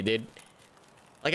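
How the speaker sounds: ordinary delivery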